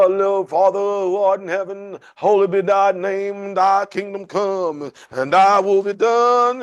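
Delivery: loud, slow, pompous prayer voice; slow, loud, hammed-up churchy intonation; mock televangelist prayer. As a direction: parody a showy TV preacher praying. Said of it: "Did he go".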